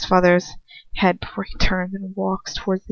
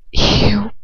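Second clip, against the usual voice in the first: ordinary delivery, nothing marked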